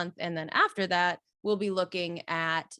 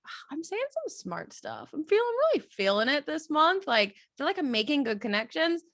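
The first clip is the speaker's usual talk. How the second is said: pleased with yourself, pitched up; self-satisfied inner monologue; 7 semitones higher than usual. Retold or imitated. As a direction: lightly imitate your own pleased thoughts. Quoting own pleased thoughts.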